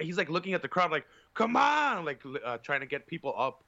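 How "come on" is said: high, louder come-on yell; mock hype shout. Imitating a drunk guy hyping the crowd, loud. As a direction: pump the crowd up like a drunk fan.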